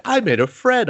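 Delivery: high, sing-song happy voice; childlike glee. Retold or imitated. The friend announcing her new friend, high childlike glee. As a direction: say it like a proud kid.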